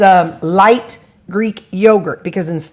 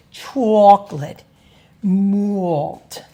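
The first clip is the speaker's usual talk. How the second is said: chocolate malt drawn out in a mock northern accent; New York accent imitation; much slower than usual. Shown as a demonstration. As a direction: do the TV New Yorker voice. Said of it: "people on the T V that talk like they're from up north".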